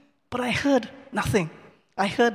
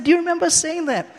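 eager question; 7 semitones higher, 4 dB louder, faster than usual. Retold or imitated. Eagerly asking the converted stranger.

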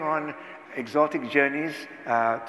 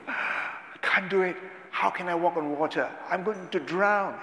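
fearful Peter; 5 semitones higher than usual. Acted. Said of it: "He was so fearful".

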